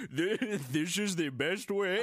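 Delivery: loud, slow, hammed-up German accent; mock German accent. Mocking co-host's failed impression, bad German accent. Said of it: "Matt's German, everybody".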